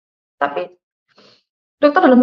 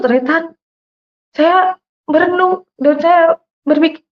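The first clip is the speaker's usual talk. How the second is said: ordinary talk, nothing marked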